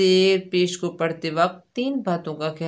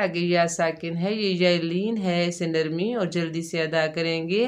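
normal talking voice

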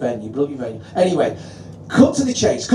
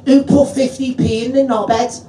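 higher Scouse voice from the crowd; imitating a Scouse heckle; lightly put-on Scouse shout from the dark; Scouse heckler; 5 semitones higher than usual. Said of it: "I just hear this Scouse voice go".

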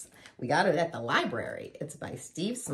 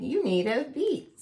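high-pitched little creature; centipede crying out; 7 semitones higher than usual. A narrator's reading. Centipede tells the others about the beat, high voice. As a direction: pipe up as a centipede. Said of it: "cried Centipede".

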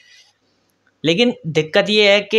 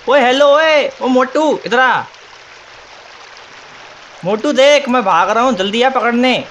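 taunting call-out; 6 semitones higher than usual. Real, not acted. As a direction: yell across the map to bait the chaser. Taunting the mob to chase him.

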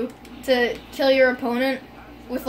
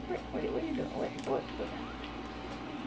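breathy unvoiced confusion; whispered disbelief; 4 semitones higher, 13 dB quieter than usual. Real, not acted. Confused at game.

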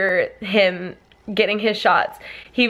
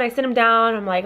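ordinary delivery

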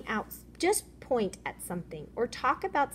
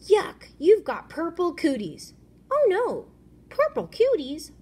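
high, loud mock alarm; character voices; 8 semitones higher, 7 dB louder than usual. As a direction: squeal it in mock fright.

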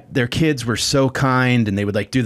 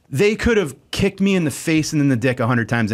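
usual speaking voice

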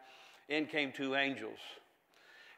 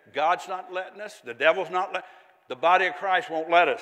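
louder, rising retold angel; a hint of the angel, louder; angel's words; 4 semitones higher, 10 dB louder than usual. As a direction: hand down the angel's message with force. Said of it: "the angel said".

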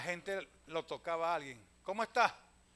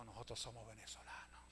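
breathy secret whisper; 8 semitones lower, 17 dB quieter than usual. Retold or imitated. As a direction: whisper it like a confession. Doctor's quote during covid. (susurrando en secreto)

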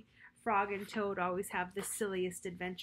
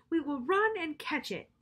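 eager Frog; 7 semitones higher, 5 dB louder than usual. Acted. Frog urges Toad.

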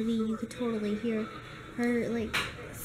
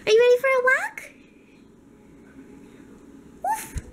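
much higher, louder sing-song puppy talk; excited pet talk; 10 semitones higher, 6 dB louder than usual. Real, not acted. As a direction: hype the dog up for his walk. Owner excitedly talks to dog.

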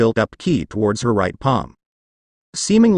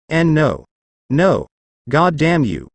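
a touch of fury, slightly raised; mild rage; 3 semitones higher, slower than usual. A narrator's reading. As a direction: spit out the curse in mild anger. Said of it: "Raditsa raged".

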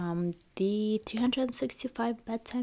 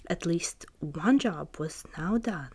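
usual speaking voice

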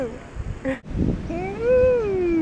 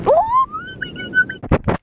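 squealing high. Real, not acted.

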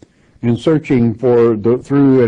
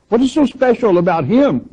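incredulous question; 10 semitones higher, faster than usual. Acted. Daughters questioning her beloved.

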